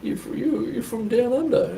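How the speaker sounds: very high-pitched mock astonishment; puzzled stranger. Stranger giving weird looks to the Australian, mock puzzled. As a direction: imitate a baffled local, voice shot right up. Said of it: "getting all these weird looks".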